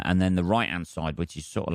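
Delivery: ordinary delivery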